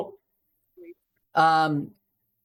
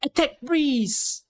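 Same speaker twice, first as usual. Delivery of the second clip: high, drawn-out mock battle cry; enemies' attack call; 11 semitones higher, much slower than usual. Coach voicing enemies targeting the last-placed Breeze, drawn-out attack call. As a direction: stretch it out like a whole enemy team calling. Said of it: "everyone will say".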